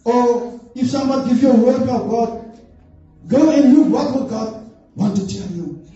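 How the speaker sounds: ordinary talk, nothing marked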